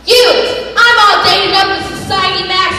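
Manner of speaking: angry. Acted. Angry quarrel.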